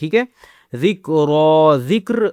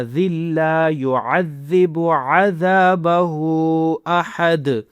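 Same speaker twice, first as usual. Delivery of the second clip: measured tajweed chant; corrected recitation. Shown as a demonstration. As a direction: recite the corrected phrase smoothly. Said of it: "لام زبر لا".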